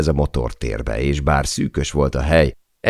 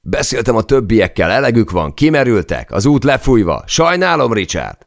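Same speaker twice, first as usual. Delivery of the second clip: high, loud yell over the storm; shouting; 9 semitones higher, 5 dB louder than usual. Acted. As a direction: keep shouting the bad news into his ear. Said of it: "üvöltötte a fülembe Chay".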